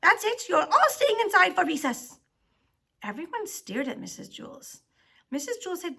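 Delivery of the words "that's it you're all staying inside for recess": loud, high scolding; angry outburst. A narrator's reading. Teacher punishing the whole class, angry and loud. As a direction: lay down the punishment at full volume.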